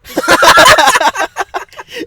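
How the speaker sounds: loud, high laugh; real high, drawn-out cackle; long burst of laughter. Real, not acted. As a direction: crack up mid-story. Cracks up at friend backing out.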